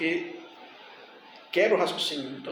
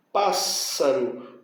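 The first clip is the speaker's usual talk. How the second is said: "Pássaro" drawn out slowly at the double S; split into syllables; 3 semitones lower, much slower than usual. Shown as a demonstration. Teacher showing how to split the syllables, slow syllable-by-syllable "Pássaro". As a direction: stretch out "Pássaro" to show the syllable break. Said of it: "é assim que eu faço a separação".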